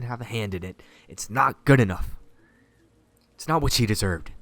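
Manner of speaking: loud, impassioned accusation; angry rant. Acted. Sarah blaming the town for Maria's death, angry rant. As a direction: let the outrage build and punch it out.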